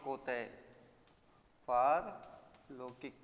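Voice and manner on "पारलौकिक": पारलौकिक drawn out very slowly, long first aa; slow careful word. Shown as a demonstration. Teacher to class, dictating slow correct पारलौकिक. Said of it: "इस तरह से शुद्ध लिखते हैं".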